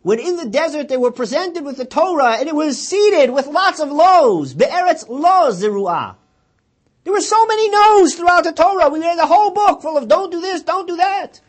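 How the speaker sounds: loud, high fervent proclamation; impassioned preaching. Acted. Preacher voicing grandfather's teaching loud and high. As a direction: preach it with full force. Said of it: "He said".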